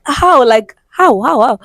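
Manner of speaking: high, pleading how; panicked disbelief. Retold or imitated. Confused about the vanished allowance, panicked pleading. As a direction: cry out the question in panic. Panicking over missing money.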